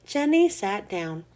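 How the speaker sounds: plain reading voice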